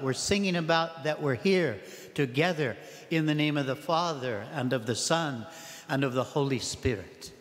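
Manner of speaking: ordinary talk, nothing marked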